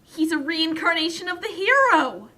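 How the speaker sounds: ordinary talk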